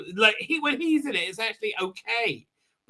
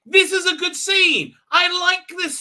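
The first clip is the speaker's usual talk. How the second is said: high, loud declaration of praise; insistent, raised-voice enthusiasm; emphatic approval; 8 semitones higher, 7 dB louder than usual. Real, not acted. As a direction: proclaim it high and loud, drawing out the words.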